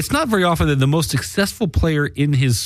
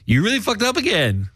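higher-pitched pretend heckle; mock jeering; 10 semitones higher than usual. Imagined listener ribbing the hosts over technical trouble, mock jeering. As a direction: tease them as a mocking listener would.

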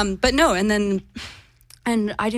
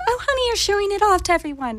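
mock British girlfriend, pitched much higher; British accent; 13 semitones higher than usual. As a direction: play the posh British girlfriend, high and sweet. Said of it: "that was all in a British accent".

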